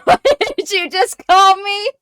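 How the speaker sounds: high, loud, affronted demand; shrieked mock indignation; mock offended. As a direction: react as if slurred, voice shooting up. Acted offence at word buggy.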